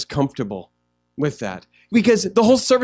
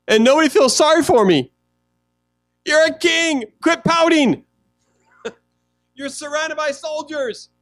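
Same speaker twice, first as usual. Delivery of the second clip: higher, louder teasing scold; mock scolding Saul; 9 semitones higher, 4 dB louder than usual. Pastor mock-scolding pouting King Saul, high and loud. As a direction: tease the sulking king to snap out of it.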